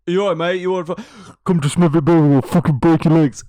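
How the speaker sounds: loud, hammed-up British hard man; mock British accent. Co-host riffing on the story's British accent, loud mock British threat. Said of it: "talking to her in a British accent".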